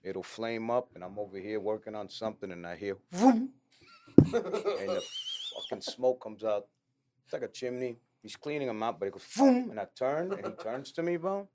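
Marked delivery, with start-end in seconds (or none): 3.1-3.5 imitated whoosh. Imitating a flame bursting up. 9.2-9.7 imitated whoosh. Imitating a flame bursting up.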